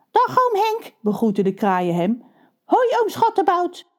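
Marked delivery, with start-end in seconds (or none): 0.1-0.9 put-on very high, chirpy young crow voice. Two young crows greeting their uncle, very high and chirpy. 2.6-3.9 put-on very high, chirpy young crow voice. Two young crows greeting their uncle, very high and chirpy.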